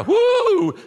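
sudden high hammed-up whoo; a jokey gasp of inspiration; loud, high mock whoo; mock whoop. Mocking a sudden urge to give.

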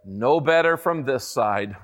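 low, slow gloomy donkey. A narrator's reading. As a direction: drag it out like a weary old donkey. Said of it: "he said".

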